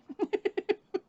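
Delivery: short high-pitched giggle; real, amused high giggle. Real, not acted. Laughing at own joke about the dog's temperature, giggle.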